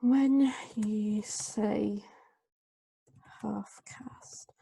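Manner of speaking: slow, drawn-out whisper. Real, not acted. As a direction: whisper it slowly while writing. Murmuring words while writing them.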